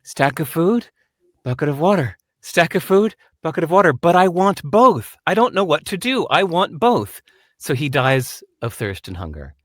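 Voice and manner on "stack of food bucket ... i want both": higher, louder, torn back-and-forth voice; indecisive donkey. Acted. Donkey halfway between food and water, fretful indecision. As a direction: play a donkey agonizing between two choices. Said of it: "and he couldn't decide".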